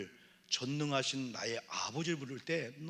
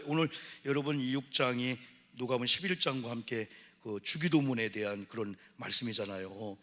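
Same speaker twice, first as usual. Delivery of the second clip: normal talking voice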